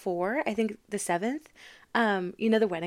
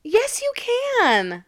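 emphatic reassurance; 13 semitones higher, 4 dB louder, much slower than usual. Real, not acted. Reassuring friend about accent.

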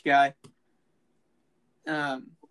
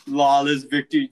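meme announcer quote; 9 dB louder than usual. Retold or imitated. Quoting the meme line.

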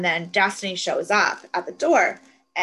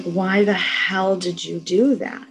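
retold outrage, louder and drawn out; freaking out; 5 dB louder, slower than usual. Recapping a TV character confronting a killer, freaking out mildly. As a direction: hit the question in mock disbelief. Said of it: "And Annie's freaking out".

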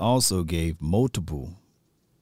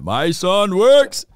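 loud, drawn-out impression of a dad; imitating deep-voiced father; 11 semitones higher, 9 dB louder, much slower than usual. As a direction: boom it out slowly like his proud dad. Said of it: "he got that real deep voice".

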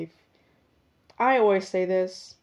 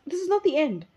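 high, dramatic "this is not the end"; solemn farewell tone; 8 semitones higher, faster than usual. Real, not acted. Podcaster to listeners, mock-solemn high farewell. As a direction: announce it like a grand finale. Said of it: "I don't know why I'm speaking like this".

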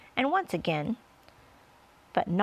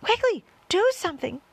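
high-pitched, panicky pleading; frantic cry; 11 semitones higher, 5 dB louder than usual. A narrator's reading. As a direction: beg urgently for action. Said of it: "cried the earthworm".

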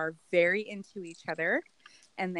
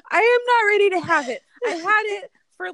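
loud, high-pitched outburst; much higher and louder, protesting; emphatic protest; 13 semitones higher, 10 dB louder, faster than usual. Real, not acted. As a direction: cry out in playful indignation. Protesting a friend's teasing judgment.

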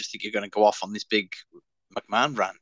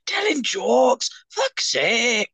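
put-on much higher, strained Glaswegian accent; Billy Connolly impression; 13 semitones higher than usual. Co-host imitating Billy Connolly, Glaswegian accent. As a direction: play a Glaswegian comic telling yarns. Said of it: "sounded just like Billy Connolly".

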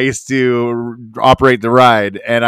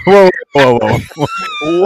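alarmed whoa; 7 semitones higher, slower than usual. Real, not acted. Reacting to sinking cruise ship.

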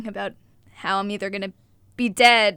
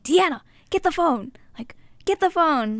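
raised, urgent call for the phone; mom yelling; 6 semitones higher, 4 dB louder than usual. Retold or imitated. Mom shouting for her daughter to bring the phone, urgent raised voice. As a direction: yell at the kid to bring the phone. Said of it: "my mom starts yelling".

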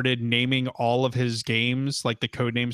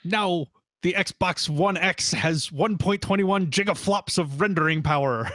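mock presenter; 6 semitones higher than usual. Mocking the conference presenter.

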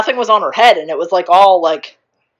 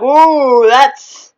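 wincing ooh; 4 semitones higher, 5 dB louder, slower than usual. Retold or imitated. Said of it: "we're like".

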